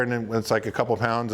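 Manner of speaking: normal talking voice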